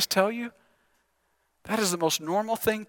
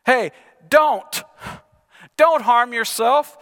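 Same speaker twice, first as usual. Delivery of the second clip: a reader's raised call, louder and higher; mock shout; 3 semitones higher, 5 dB louder, slower than usual. A narrator's reading. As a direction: call out to stop him. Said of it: "But Paul shouted".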